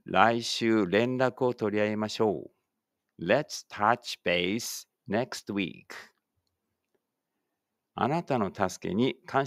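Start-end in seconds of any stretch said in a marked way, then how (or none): 3.2-5.8 slow, carefully spaced English for the class to repeat; slow model reading. Shown as a demonstration. Teacher models English for pupils reading aloud together, slow and clear. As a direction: say each word slowly and clearly, like a teacher modeling. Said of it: "一緒に声出してください".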